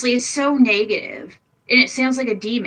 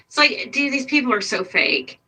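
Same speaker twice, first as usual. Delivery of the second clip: normal talking voice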